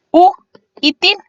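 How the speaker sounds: U and TIL said apart, a pause after U; syllable by syllable. Shown as a demonstration. Teacher splitting útil for students, syllable by syllable. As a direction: break the word into "u" and "til". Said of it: "se divide en dos sílabas".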